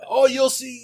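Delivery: high-pitched mock southern voice; southern accent. Mimicking a customer's warning, southern accent. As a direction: put on a southern accent through laughter. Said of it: "like, a southern accent on it".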